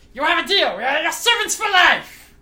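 tiny sprite crying out in delight; loud high fairy voice. Acted. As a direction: squeal it like a greedy little pixie. GM fairy accepting deal.

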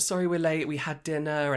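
a hint of a high celebrity voice. Star apologising to waiting staff, lightly imitated high voice. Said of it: "she went".

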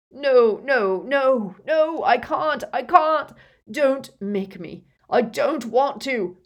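high, loud, frantic pleading; screaming. A narrator's reading. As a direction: scream in terror and torment. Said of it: "Dumbledore screamed".

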